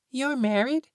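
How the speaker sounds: put-on high, insistent kid; demanding child. A narrator's reading. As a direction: demand an answer. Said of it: "Fee demanded".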